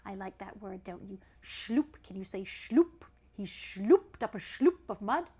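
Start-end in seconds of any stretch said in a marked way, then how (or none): none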